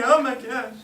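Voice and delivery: hammed-up tearful exclamation; mock crying. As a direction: play a crying kid for laughs. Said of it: "And I'm crying, going".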